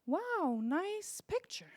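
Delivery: high, drawn-out mock delight; over-sweet hollow compliment; fake gushing praise. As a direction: gush like a parent faking wonder.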